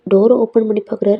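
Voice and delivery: ordinary delivery, nothing marked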